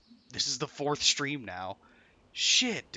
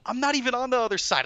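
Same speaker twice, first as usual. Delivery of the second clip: yelling; 11 semitones higher, 6 dB louder, faster than usual. Real, not acted. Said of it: "I don't mean to yell at you".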